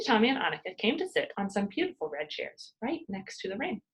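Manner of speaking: ordinary delivery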